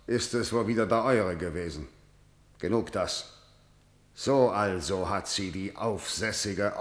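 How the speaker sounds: low, soft and contained; curt dismissal. Acted. Kreon ending the elders' objection, low and dismissive. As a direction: sink the voice to shut the argument down.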